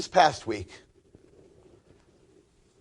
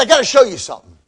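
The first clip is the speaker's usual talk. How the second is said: biker's blunt demand; 8 semitones higher, 12 dB louder, faster than usual. Retold or imitated. Biker confronting rabbi.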